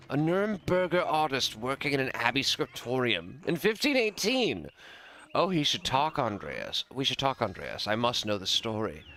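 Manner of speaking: nobleman's delight, swooping up in surprise; intrigued lord. Acted. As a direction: marvel like an eager aristocrat.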